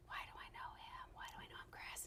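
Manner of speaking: hushed whisper to a friend. Retold or imitated. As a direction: whisper it so he can't hear. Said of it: "Megan looks at me and is like".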